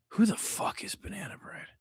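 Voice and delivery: breathless whispered aside; whispered question about a chatter. Real, not acted. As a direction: whisper it to yourself, puzzled. Aside about a chat user.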